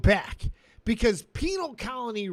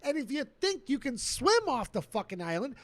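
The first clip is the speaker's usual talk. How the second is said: ordinary talk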